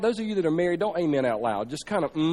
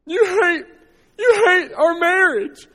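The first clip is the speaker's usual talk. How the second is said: high, loud mock crying wife; hammed-up tearful wife voice; 10 semitones higher, 5 dB louder, slower than usual. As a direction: wail it like a hurt wife. Wife crying retold in story.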